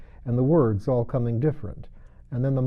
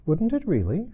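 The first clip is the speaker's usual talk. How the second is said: much higher, astonished question; great surprise; 10 semitones higher than usual. A narrator's reading. Alice to the Mock Turtle, in great surprise. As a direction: gasp it out in disbelief. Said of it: "in a tone of great surprise".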